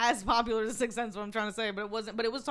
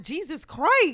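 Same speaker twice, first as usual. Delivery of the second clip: higher, louder exclamation of disbelief; mock shock; 6 semitones higher, 6 dB louder, much slower than usual. Viewer reacting to the orphan's threat, mock shocked. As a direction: exclaim it, appalled for comic effect. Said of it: "I'm like".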